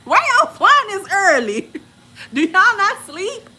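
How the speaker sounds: high, loud disbelief; incredulous complaint. Acted. Traveler griping about the early flight, incredulous. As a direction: exclaim it in exasperated disbelief.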